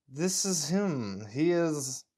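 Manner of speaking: put-on slow, drawn-out German accent; a slightly Russian-tinged German, very slow. Officer seeing the first clone boy, slow German accent. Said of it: "my German accent slips a little into Russian".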